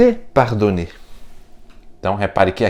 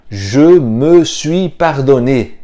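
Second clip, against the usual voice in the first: slow, careful French sentence; slow model phrase; 3 semitones higher, 6 dB louder, much slower than usual. Shown as a demonstration. Teacher slowly models the French for students. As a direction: spell it out slowly for the learner.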